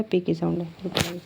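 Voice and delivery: ordinary talk, nothing marked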